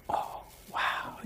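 hushed mock astonishment; mock whispered gasp. Preacher feigning shock at the congregation, whispered. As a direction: breathe it out as a stunned whisper.

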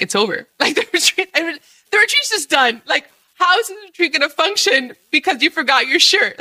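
high, hammed-up panic over a forgotten shirt; mock panic. Retelling her inner panic to viewers, hammed-up and high. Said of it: "in my mind, I was like".

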